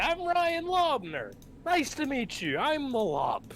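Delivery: very high, slow mocking voice; squeaky mock Ryan impression; imitating Ryan. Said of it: "If I sound like that".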